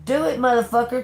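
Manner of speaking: cocky, fast and loud challenge; mock bold dare toward the haters; defiant dare. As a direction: spit it out daring them. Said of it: "Now it's like".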